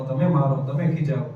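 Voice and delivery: normal talking voice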